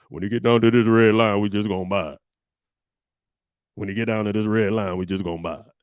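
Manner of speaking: low, put-on money-talk voice, smooth and sure; millionaire TV voice. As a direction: play the slick TV millionaire explaining the red line. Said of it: "why did I use all the voices".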